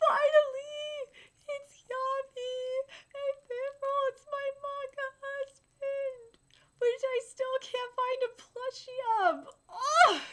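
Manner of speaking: high-pitched, drawn-out tearful gushing; excited fangirl squeal. Real, not acted. Reader greets favourite manga character, overjoyed squeal.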